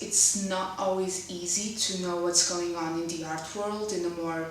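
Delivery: ordinary talk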